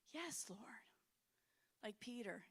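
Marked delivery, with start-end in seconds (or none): 0.1-0.8 hushed, whispered yes; whispered surrender. Retold or imitated. Praying woman whispering her yes to God. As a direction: breathe it out in surrender.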